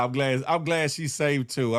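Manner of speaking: ordinary talk, nothing marked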